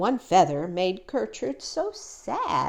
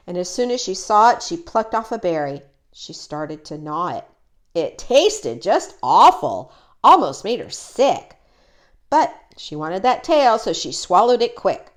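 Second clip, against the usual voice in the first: ordinary delivery, nothing marked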